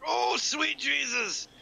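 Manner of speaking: high, slow shout of alarm; shocked shout. Real, not acted. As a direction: yell it in sudden shock. Sudden shock at sea.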